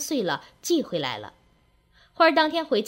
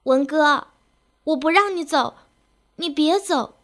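higher, louder tearful plea; crying; 5 semitones higher, 3 dB louder than usual. A narrator's reading. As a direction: beg him to stay through tears. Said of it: "哭着说".

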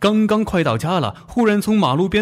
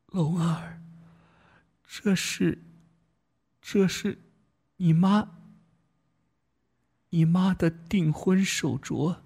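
faint, slow, halting strained voice; dying words; 3 semitones higher, 6 dB quieter, slower than usual. Acted. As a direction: push out each word with effort. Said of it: "她吃力地说".

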